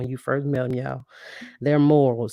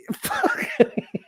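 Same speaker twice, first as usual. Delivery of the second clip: breathless high-pitched cackle; high squealing laugh. Real, not acted. Bursts out laughing at joke.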